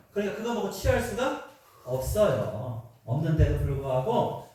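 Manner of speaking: ordinary talk, nothing marked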